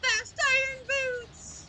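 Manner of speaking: high, slow, drawn-out delight; excited. Real, not acted. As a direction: stretch it out in gleeful glee. Delighted at randomizer perk.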